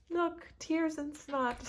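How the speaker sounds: high, slow teary voice through laughter; laugh-cry through the tears; real tears, half laughing; crying while laughing. Real, not acted. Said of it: "I'm now crying".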